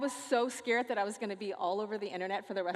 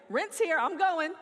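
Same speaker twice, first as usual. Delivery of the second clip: higher, louder snap decision; mock eagerness; 6 semitones higher, 5 dB louder than usual. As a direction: play an eager theatregoer. Acts out eager theatregoer.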